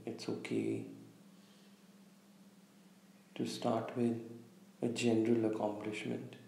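calm meditation guide, long pauses between phrases; unhurried, slightly hushed meditation delivery; guided visualization. Real, not acted. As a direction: lead listeners gently inward, leaving space after each instruction. Leads a guided visualization exercise.

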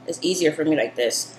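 normal talking voice

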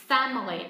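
"Family" with strong FAM, two syllables dropping; falling last syllables. Shown as a demonstration. Teacher shows the fall for learners, loud FAM then dropping. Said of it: "xuống giọng hai tiết còn lại".

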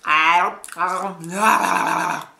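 creepy mock zombie groans; hammed-up creepy devil craving blood; Sanan. Reviewer playing Sanan craving Chizuru's blood, creepy voice. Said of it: "he's super creepy and he's like".

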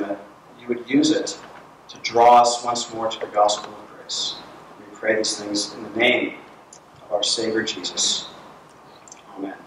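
low, soft prayerful voice. Real, not acted. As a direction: pray quietly and reverently. Leads the opening prayer.

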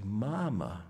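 "Mama" with a swooping lilt on the first syllable; sing-song parent modelling "Mama"; mouthing for baby. Acted. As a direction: coax a baby to copy "Mama". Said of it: "Then you mouth the words".

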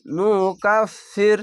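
নুকাফির chanted slowly in recitation voice; Quranic recitation. Shown as a demonstration. Teacher reciting the word for students.

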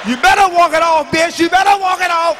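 loud, high-pitched warning; hammed-up threat. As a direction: shout after someone walking away. Acted threat as child walks past.

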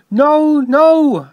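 high, loud cry of refusal; alarmed refusal. A narrator's reading. Toby refuses the ghost entry.

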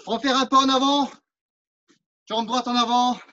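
normal talking voice

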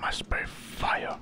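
unvoiced, slow whisper of the game line; whispered echo. Streamer whispering back the hero's game line. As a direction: breathe the words out as he reads along.

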